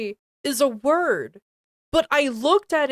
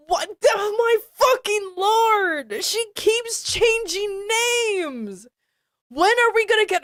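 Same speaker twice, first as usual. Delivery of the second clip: high, raised-voice disbelief; comic exasperated rant; exasperated outburst; 8 semitones higher than usual. Real, not acted. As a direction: cry out at the ceiling.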